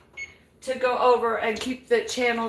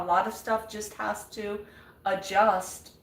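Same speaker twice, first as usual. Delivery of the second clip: ordinary talk, nothing marked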